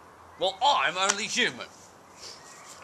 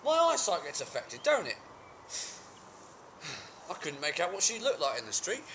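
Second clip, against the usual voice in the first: high, protesting self-defence; aggrieved excuse-making; 8 semitones higher than usual. Acted. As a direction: plead your case in a high, wheedling pitch. Character makes excuses to partner.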